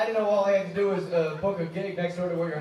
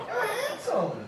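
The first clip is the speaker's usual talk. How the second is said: high, doting mama voice; Big Mama; 13 semitones higher, 6 dB quieter, much slower than usual. Acted. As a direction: sweet-talk him like a big mama. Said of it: "Big Mama Got It All".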